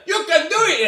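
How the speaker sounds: high Cajun "you can do it"; Adam Sandler Cajun cheer; Cajun accent. Retold or imitated. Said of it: "and I just did the accent".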